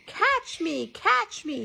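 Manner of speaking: put-on high, loud child's taunt; playful high-pitched yell; shouting. A narrator's reading. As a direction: shout it like a kid dashing off. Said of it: "he shouted".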